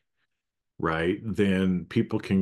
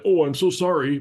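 gushing apology; 10 semitones higher, 6 dB louder than usual. Retold or imitated. What he wanted to say.

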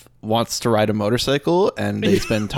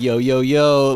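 louder, lightly mimicked hype greeting; imitating actor's slang; 2 semitones higher, 5 dB louder, slower than usual. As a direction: do a hint of his showy street greeting. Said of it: "he talks like that to them".

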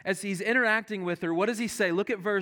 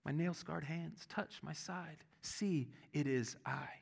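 much softer, hushed and tender; gentle grace; 3 semitones lower, 13 dB quieter than usual. Acted. Jesus gently inviting Thomas to touch, hushed and tender. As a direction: offer it almost in a whisper, full of grace. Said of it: "comes at him with such grace".

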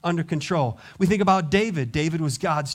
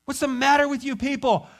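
high, louder mock indignation; indignant outburst; 8 semitones higher, 3 dB louder than usual. Jesus imagined demanding respect, hammed-up indignant. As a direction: play the offended big shot. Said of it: "did we hear him saying".

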